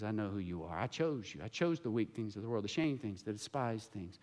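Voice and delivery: soft, low reassurance; gentle, reassuring God. Retold or imitated. God telling the sinner he was chosen, gently. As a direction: speak it quietly, tenderly, like a loving father. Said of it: "he looks at me and he says".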